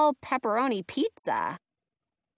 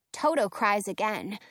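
ordinary delivery

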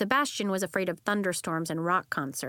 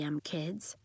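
whispered; 4 semitones lower, 7 dB quieter than usual. Acted. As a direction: whisper the end. Trailing whispered end.

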